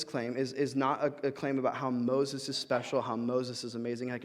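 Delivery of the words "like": ordinary talk, nothing marked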